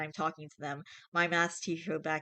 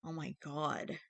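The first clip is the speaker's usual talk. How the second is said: 1 hushed shock; 8 dB quieter, slower than usual. Real, not acted. Shocked reaction to sad story.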